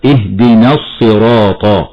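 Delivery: slow, the hamza of الصراط dropped, straight from نا into a doubled ص; careful Quran recitation; tajweed recitation. Shown as a demonstration. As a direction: link اهدنا الصراط with no hamza. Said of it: "نعم، هكذا".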